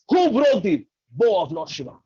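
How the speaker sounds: acted outrage, easing off toward the end; angry demand. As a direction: demand to know the culprit. Enraged Parshuram demands the culprit.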